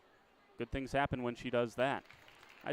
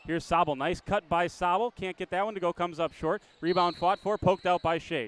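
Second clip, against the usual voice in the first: louder, higher as the rebound is fought for; excited call; 6 semitones higher, 7 dB louder than usual. Real, not acted. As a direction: build with the scramble under the rim. Scramble under the basket.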